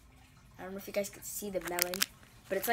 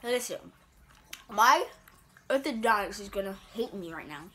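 talking while chewing; chewing between words; mouth full of candy; 3 dB louder than usual. Real, not acted. Seen 0: Holds a candy piece, lips pressed and chewing while talking early on.

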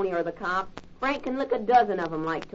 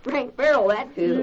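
teasing shout; 6 semitones higher, 5 dB louder, slower than usual. Acted. Kids tease Frank about Helen.

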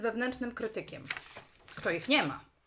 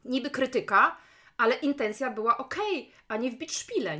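ordinary talk

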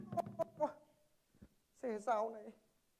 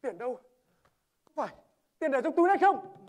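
threatening demand; 3 semitones higher, 14 dB louder than usual. Acted. Robber demands the money, loud and threatening. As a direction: bark the question at him.

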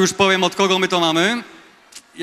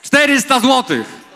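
excited shout; 6 semitones higher, slower than usual. Real, not acted. Auction bid jumps high.

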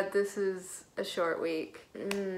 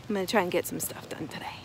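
whisper; 3 semitones lower, much faster than usual. Real, not acted. Quiet aside to camera.

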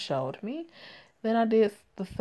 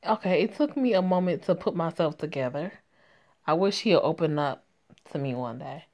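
ordinary delivery, nothing marked

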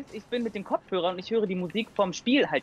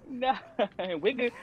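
ordinary talk

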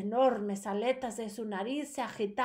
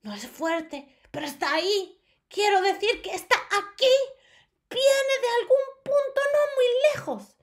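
high, loud witch voice; high witch sniffing out a child; shouting witch; 11 semitones higher, 7 dB louder than usual. A narrator's reading. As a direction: screech like an excited witch. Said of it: "gritó la primera bruja".

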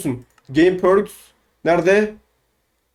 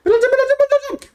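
very high, frantic squeaky chant; excited squeal; faster than usual. Pretend. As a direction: squeal it greedily, far above your normal voice. Excitedly claiming the loot first.

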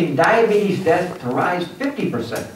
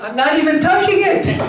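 high, laughing whiny voice, much higher pitch; mock sulky adult; 13 semitones higher than usual. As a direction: play the wounded grown-up, half-laughing. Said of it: "and you're like".

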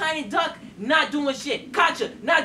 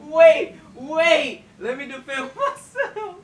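laughing plea; slower than usual. Real, not acted. Laughing while pleading.

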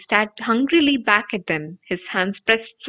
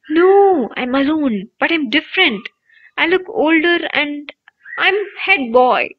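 a hint of an excited boy, higher; lightly voiced Ron; 6 semitones higher, 3 dB louder than usual. A narrator's reading. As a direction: boyish wonder, higher voice. Ron reads his mirror vision.

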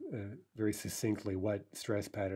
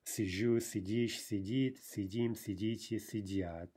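every form from Сижу to сидят stressed on its ending; end stress throughout. Shown as a demonstration. Teacher reciting the conjugation, Сижу to сидят stressed on endings. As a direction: list them, hitting ЖУ, ДИШЬ, ДИТ. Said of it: "stay on the ending everywhere".

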